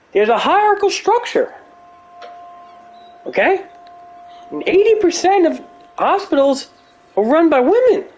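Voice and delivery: high-pitched mock Jordan Peterson holding forth; Jordan Peterson impression. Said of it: "I can do a Jordan Peterson impersonation".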